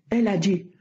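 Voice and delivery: ordinary talk, nothing marked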